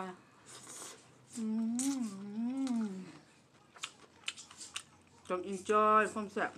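noodles in mouth, slurping; speaking between bites; talking while eating noodles. Real, not acted. Seen 1: Lifts noodles and talks, then noodles enter her mouth near end.

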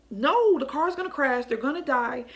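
mild panic, voice jumping up; retold panic. Mother watching the car about to crash, retold panic. Panicking over children in dream.

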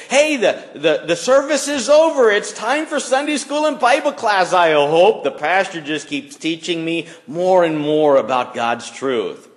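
high, bright-eyed, overeager chatter; eager churchgoer. Pretend. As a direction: gush about staying for class, hammed up. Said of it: "Or like this".

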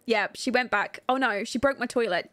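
ordinary talk, nothing marked

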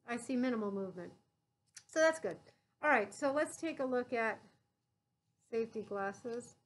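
muffled behind surgical mask; speaking under cloth covering; through a face mask. Real, not acted. Seen 3: Mask covers mouth and nose throughout labelled speech; references unmasked.

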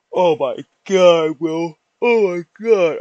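talking through a yawn. Real, not acted. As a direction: stretch it out mid-yawn. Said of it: "I know I'm yawning".

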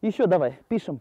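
ordinary talk

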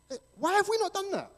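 high-pitched, incredulous outburst; incredulous question. Real, not acted. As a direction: ask it in high-pitched disbelief. Rhetorical question about proven success elsewhere.

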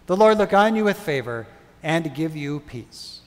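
ordinary delivery

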